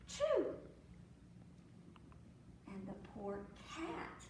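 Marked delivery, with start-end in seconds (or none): 0.1-0.4 gasp, then a high, loud "choo"; mimed sneeze. A narrator's reading. Storyteller as the rooster catching cold, mimed high sneeze. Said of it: "and the sneeze".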